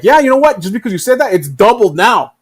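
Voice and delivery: hammed-up bully raising the price; mock gloating bully. USA stand doubles the fee, loud mock gloat. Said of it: "And the U S A is like".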